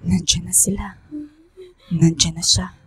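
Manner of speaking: hushed unvoiced whisper of dread. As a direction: breathe it out as the spirits arrive. Spirit arrives, professor warns.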